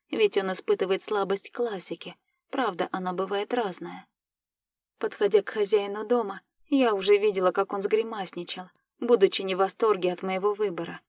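plain reading voice

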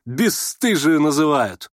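slow down on the insult, amused; scornful merriment. A narrator's reading. Nastasya Filippovna parrying an insult, scornful gaiety. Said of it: "с пренебрежительной весёлостью отпарировала".

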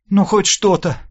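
high-pitched, mildly grumbling woman's line. A narrator's reading. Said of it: "проворчала Лина".

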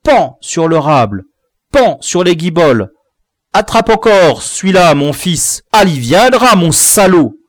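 mock the victim as you hit him; a hint of a bully's gloating cry; gleeful taunting. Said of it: "disait l'Aztèque".

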